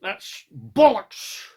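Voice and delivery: put-on slow, emphatic English accent. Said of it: "Gotta say that with an English accent".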